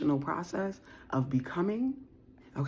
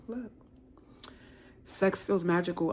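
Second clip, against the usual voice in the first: ordinary talk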